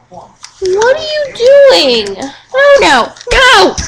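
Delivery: high, slow alarmed question; sudden alarm at the dog. Real, not acted.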